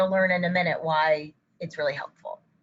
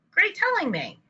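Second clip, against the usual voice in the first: much higher, sing-song parent praise; enthusiastic praise; 10 semitones higher than usual. Shown as a demonstration. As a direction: cheer a child on, pitch swooping down. Parent praising child for speaking.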